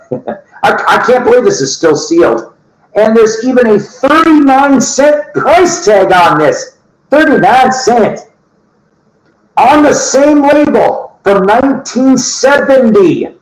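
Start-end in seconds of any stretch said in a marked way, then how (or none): 3.0-13.4 much higher, slowed for emphasis; excited. Real, not acted. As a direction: marvel at the find, rising excitement. Excited about sealed record.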